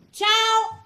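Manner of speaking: a sudden loud, high "Ciao!"; a husband's single blurted greeting, pitched high; Carmè's terse ciao. Retold or imitated. Husband greets her friends, one loud high hello. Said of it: "Lui dice solo".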